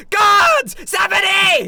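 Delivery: high, loud yell for the guards; panicked scream. Acted. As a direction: scream for help at the top of your lungs. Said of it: "he starts screaming at the top of his lungs".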